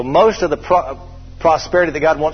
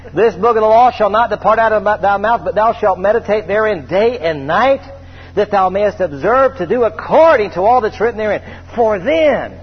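solemn scripture reading; 5 semitones higher, 4 dB louder than usual. Acted. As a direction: read it out like holy writ to the congregation.

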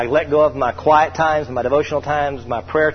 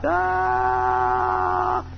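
falling yell; 4 dB quieter than usual. Retold or imitated. Man falling from top deck.

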